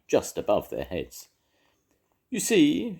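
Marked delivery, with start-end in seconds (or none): 2.3-3.0 high cooing owl voice. A narrator's reading. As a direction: coo it out sweetly. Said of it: "she cooed".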